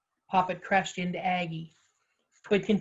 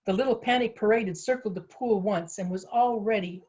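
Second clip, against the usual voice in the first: ordinary delivery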